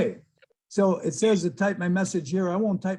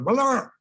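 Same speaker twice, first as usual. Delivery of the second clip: high guttural blurt imitating speech; imitated guttural bark of foreign words; guttural outburst; 7 semitones higher, 3 dB louder than usual. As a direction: throw out a mock guttural foreign sound. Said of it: "it's a guttural type of sound".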